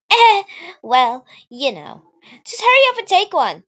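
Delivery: high, loud and flustered; flustered tsundere girl. Acted. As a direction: brush it off in embarrassment. Natsuki flustered.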